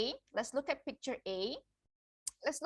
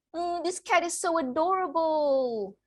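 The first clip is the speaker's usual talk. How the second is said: higher, louder gushing over a cute cat; delighted cat-lover gush; cooing over cat; 7 semitones higher, 8 dB louder than usual. Acted. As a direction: coo like someone smitten with a kitten. Voicing admiration of cat.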